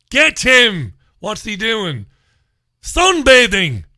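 high, loud, hammed-up alarm; mock police shouting. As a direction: shout like an outraged officer giving chase. Acting out police catching a sunbather.

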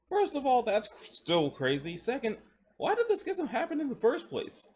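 put-on high, eager young man asking. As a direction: push up high, curious and insistent. Adam's voice in the story.

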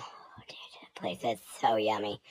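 fast hushed whisper. Real, not acted. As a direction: lean in and whisper the secret. Confiding about favourite restaurant.

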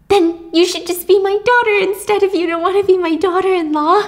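lightly voiced pushy mother-in-law; pushy mom voice. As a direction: play the clingy mom begging.

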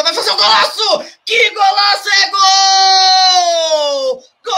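huge rising shout, pitch soaring; explosive goal commentary. Real, not acted. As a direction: scream the goal call with everything. Goal scored from a free kick.

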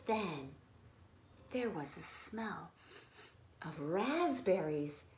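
hushed suspense. A narrator's reading. Story grows suspenseful.

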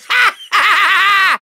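long, high villain cackle; evil cackle. Acted. Villain gloats over a trick up his sleeve, maniacal laugh. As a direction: cackle triumphantly, drawn right out.

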